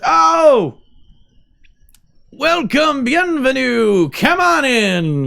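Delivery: loud, high, drawn-out greeting; effusive host hailing guests; exuberant welcome. Acted. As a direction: call out a big delighted welcome. Host spots arriving guests, calls out.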